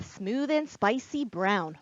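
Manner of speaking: ordinary delivery, nothing marked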